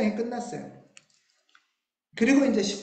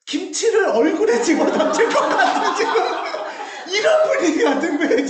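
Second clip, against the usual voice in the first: laughing; 6 semitones higher, 5 dB louder than usual. Real, not acted. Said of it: "아침 드라마의 거의 전장 같은".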